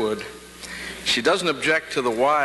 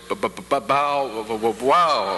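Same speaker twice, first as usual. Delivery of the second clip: stuttering dog bark. Acted. Telling graduates a joke about stuttering dogs, stuttering bark. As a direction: bark like a dog stuck on its first sound. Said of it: "if a dog has a stutter".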